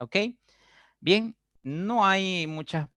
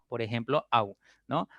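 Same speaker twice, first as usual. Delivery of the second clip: normal talking voice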